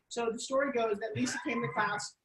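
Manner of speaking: ordinary talk, nothing marked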